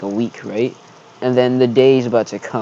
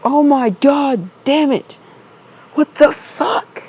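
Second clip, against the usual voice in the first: much higher, slow frustrated groan of words; high exasperated outburst; 13 semitones higher, slower than usual. Retold or imitated. Freshman cursing to himself, high and exasperated. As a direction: whine it up high in disbelief.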